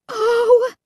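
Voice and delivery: high, sharp gasp for air; breathless gasp. A narrator's reading. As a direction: gasp with the wind knocked out. Gasping under the monster's foot.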